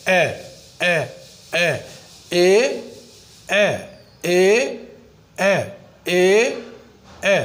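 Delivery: drilling ए for Urdu learners, teacher demonstrates ए not आ; English a vowel. Shown as a demonstration. ए said clearly, over and over, never as आ. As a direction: repeat ए as a short open ए sound, not आ. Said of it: "आ नहीं है बल्कि ए".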